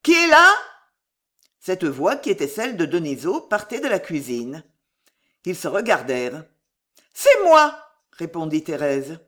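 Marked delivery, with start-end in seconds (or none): 0.0-0.5 loud, high call from another room; high-pitched "who goes there" shout; cried out. A narrator's reading. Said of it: "une voix s'écria". 7.1-7.8 loud, high answering call; high, ringing feminine call; calling back. A narrator's reading. Thérèse calls back through the door.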